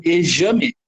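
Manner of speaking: "exame" said with a zh sound for the x; wrong pronunciation demo. Shown as a demonstration. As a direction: say "exame" as "ejame". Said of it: "Como que eu falo exame?".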